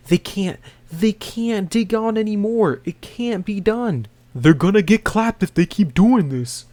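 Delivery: high panicky voice, hammed up; mock freak-out. Colt panicking to the commander, high hammed-up panic. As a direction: squeal it out like a soldier losing it. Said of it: "Colt just freaks out and says".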